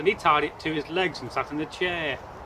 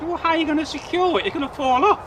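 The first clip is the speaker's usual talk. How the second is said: higher, fast anxious question; worried young man; 9 semitones higher, 4 dB louder, faster than usual. Acted. Young man fears the cow will fall off. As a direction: rush the worry out.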